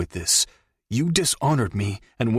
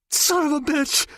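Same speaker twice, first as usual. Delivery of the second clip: high shriek of pain; pained cry. Acted. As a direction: howl it as the nail hits. Nail hammered through his hand.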